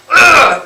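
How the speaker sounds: loud, high "ugh" of real frustration; fed-up, forceful groan; angry groan. Real, not acted. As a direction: groan out your exasperation. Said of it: "it pisses me off so much".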